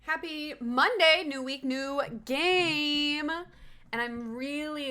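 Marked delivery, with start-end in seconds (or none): 0.0-3.3 loud, bright, slow opening hello; excited greeting. Real, not acted. Vlogger hyping her viewers at the start. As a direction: greet the viewers with big energy.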